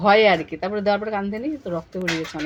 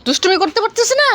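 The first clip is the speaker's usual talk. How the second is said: high, loud laughing words; 11 semitones higher, 8 dB louder than usual. Real, not acted. Mother laughs at the playful child, loud and high. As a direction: laugh through the line.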